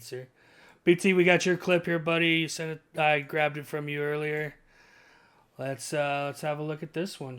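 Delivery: ordinary talk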